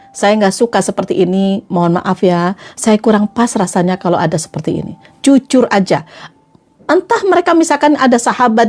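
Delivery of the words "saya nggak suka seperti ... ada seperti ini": low, calm apologetic objection; polite frankness. Shown as a demonstration. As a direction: object courteously, voice dropped. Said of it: "Berani untuk bilang". (keberatan dengan sopan, nada rendah)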